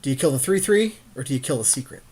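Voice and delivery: ordinary talk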